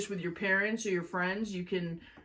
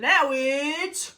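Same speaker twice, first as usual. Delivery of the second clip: higher, louder, slow showbiz announcement; announcer flourish; 6 semitones higher, 4 dB louder, much slower than usual. Real, not acted. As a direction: announce the segment like a game show host. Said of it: "review time with [name]".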